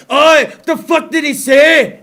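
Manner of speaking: hammed-up angry Irish heckler, drawn out. Host imitating a mad Irish listener, in mock Irish. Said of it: "some weird fucking Celtic person just mad".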